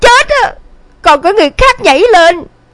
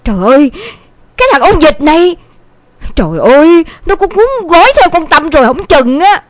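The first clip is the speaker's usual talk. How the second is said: exasperated, groaning grumble; angry mother; 4 dB louder than usual. A narrator's reading. Mother reacts to son running off, mildly furious lament. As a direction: curse a runaway son with understated fury. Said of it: "Thấy mẹ bốc hỏa giận dữ".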